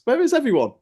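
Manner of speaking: high, loud plea to an absent crowd; exasperated calling out. Acted. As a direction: call out in mock desperation, pitch soaring. Calls out for missing protesters.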